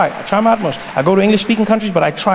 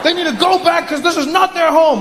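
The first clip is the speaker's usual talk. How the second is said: high, emphatic nativist rant; self-righteous complaint; 11 semitones higher than usual. Acted. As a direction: insist with mock outrage. Mocks confident anti-immigrant speakers.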